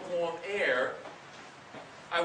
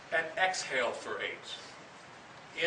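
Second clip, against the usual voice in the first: slow exhale; 3 semitones lower, 5 dB quieter, much slower than usual. Shown as a demonstration. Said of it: "inhale for eight".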